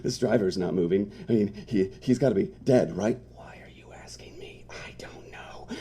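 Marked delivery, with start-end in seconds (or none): 3.3-5.7 hushed frightened whisper; whispering near the body. Acted. Dead driver at feet.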